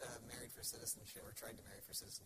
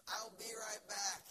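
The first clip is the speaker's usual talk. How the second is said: high, loud, stretched-out call; mocking sign-off; 11 semitones higher, 5 dB louder, much slower than usual. Man leaving his fiancée for good, mock-casual high call. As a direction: sing out the parting line, slowly and mockingly. Mocking replay of last words.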